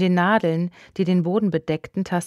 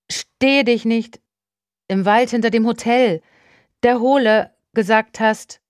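raised phone voice; 5 semitones higher, 5 dB louder than usual. A narrator's reading. Calling from the woods on a breaking phone line, raised voice. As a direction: struggle to get through on a bad connection. Broken-up phone call, straining to be understood.

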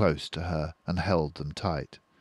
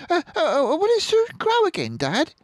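put-on very high, quick child's voice; tiny cub, high and fast; small fox child; 7 dB louder than usual. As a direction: stammer like a little cub. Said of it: "said one of the small foxes".

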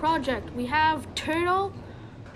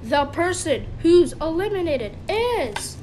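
show host; 4 dB louder than usual. Pretend. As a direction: announce it with suspense, swooping on each phrase. Host announces the elimination.

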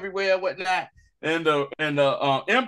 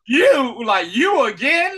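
shocked disbelief; 7 semitones higher, 5 dB louder, slower than usual. Retold or imitated. Dedra shocked, retold.

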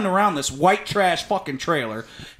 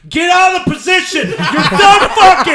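screaming; 12 semitones higher, 10 dB louder than usual. Retold or imitated. Boyfriend screaming at his cheating girlfriend, loud high scream. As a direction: scream it at her across the room. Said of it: "I'm screaming at her".

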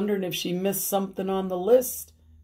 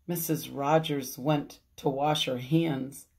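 plain reading voice

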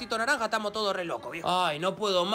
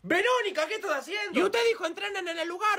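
higher, exasperated teacher; scolding gym teacher; 7 semitones higher than usual. Acted. Coach scolding Verónica in class, higher exasperated. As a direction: scold the student in a high pitch.

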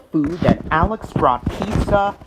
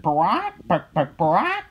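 chicken squawks; 4 semitones higher than usual. A narrator's reading. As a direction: squawk like a barn full of hens. Chickens clucking inside barn.

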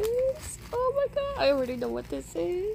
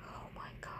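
whisper; 15 dB quieter, much faster than usual. Real, not acted. Whispered awe.

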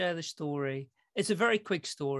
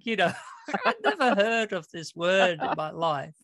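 normal talking voice

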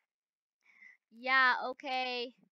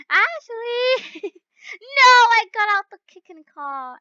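tearful laughing shout; 10 semitones higher, 11 dB louder, slower than usual. Real, not acted. Crying with laughter.

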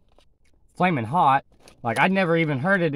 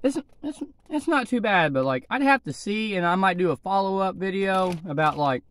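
talking around food; slightly muffled chewing speech; mouth full, chewing; 3 semitones higher than usual. Real, not acted. Seen 1: Pursed, working lips early on; chip bag at mouth; then talks freely.

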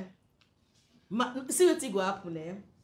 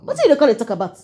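loud demand; 16 dB louder, faster than usual. Acted. Madam suspicious, loud.

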